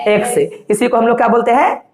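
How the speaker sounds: ordinary talk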